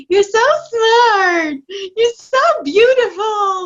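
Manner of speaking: high, slow, syrupy mock praise of a child; fake gushing praise. Parent coach mocking an inauthentic mom gushing at her child, high and slow. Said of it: "You have to do it in an authentic way".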